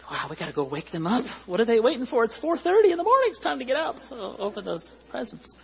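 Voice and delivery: put-on high, hurried kid voice; impatient children on Christmas morning; excited kids.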